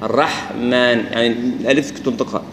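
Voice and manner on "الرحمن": الرحمن drawn out slowly, alif clearly voiced without nasal blur; slow correct reading. Shown as a demonstration. Teacher models الرحمن slowly and carefully. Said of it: "لا، يا الرحمن".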